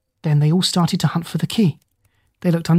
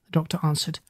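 usual speaking voice

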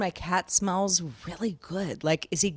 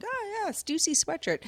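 put-on higher, softer, airy teen voice; mock trendy kid; 9 semitones higher, 5 dB quieter than usual. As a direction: lightly imitate a casual kid naming a brand. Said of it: "it was like".

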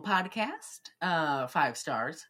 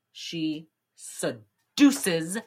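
ordinary talk

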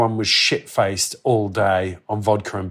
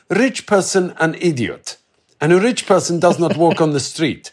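put-on light Russian accent; 5 semitones higher than usual. Quoting Val, a Russian man.

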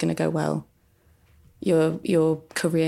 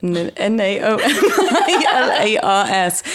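louder, higher, slowed by laughter; laughing while spelling; 4 semitones higher, 6 dB louder, slower than usual. Real, not acted. Spelling out her handle, breaking into laughter.

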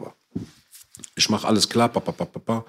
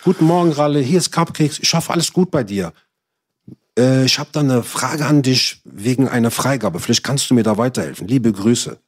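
raised, polite office-greeting voice; mock bureaucratic courtesy, pitched up at the start; office-clerk politeness; 2 semitones higher than usual. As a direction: imitate a very proper civil servant's message. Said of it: "So diese Beamtensprache mäßig".

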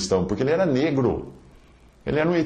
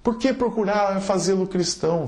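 quoting Kardec; 10 semitones higher than usual. Acted. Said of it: "abre aspas".

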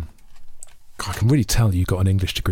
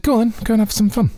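mock posh husband; 12 semitones higher than usual. Quoting the husband, high voice.

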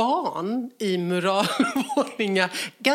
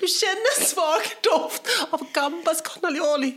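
much higher, breathless giggling; higher, giggling through a Spanish-evening daydream; laughing fantasy; 7 semitones higher, slower than usual. Real, not acted. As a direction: dream aloud while laughing. Dreamy scene, laughing.

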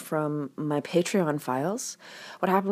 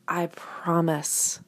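ordinary delivery

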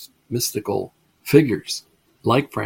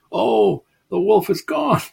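higher, hammed-up mock grief; mock lament; 10 semitones higher, 4 dB louder than usual. Townspeople mourn their dead wolf, a light mock lament. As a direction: play the townspeople's mock sorrow.

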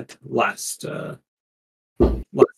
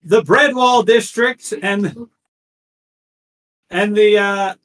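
town crier; 10 semitones higher, slower than usual. Acted. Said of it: "he kinda keeps reading it".

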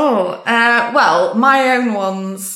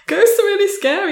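put-on high, whiny little kid; imitating a frightened young son; scared child voice; 12 semitones higher than usual. Son voiced in retold talk.

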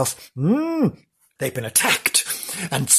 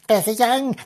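put-on higher, little-girl voice; hint at a ghostly girl; lightly voiced young girl; 8 semitones higher than usual. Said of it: "there's a young girl's voice".

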